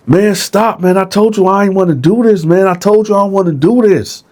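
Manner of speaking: Dylan pleading with Bone to leave him alone; pleading cellmate. Retold or imitated. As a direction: beg him to stop, half-mocking.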